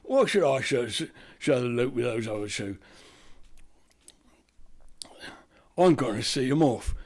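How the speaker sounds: put-on Slab in a light East London accent. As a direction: redo it with a Cockney twang. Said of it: "East London accent, wasn't it?".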